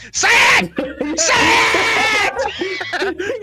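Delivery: comic screaming at the student to answer; Sam Kinison scream. Retold or imitated. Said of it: "Sam Kinnison's character goes".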